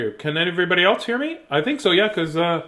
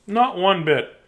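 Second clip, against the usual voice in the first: ordinary delivery, nothing marked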